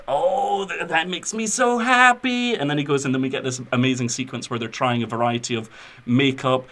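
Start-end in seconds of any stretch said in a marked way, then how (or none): 0.1-2.5 high, swooping imitation of delight; overjoyed quote. Retelling the makeup-artist brother's reaction, hammed-up joy. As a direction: gush it in a hammed-up, soaring voice. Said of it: "and he's like".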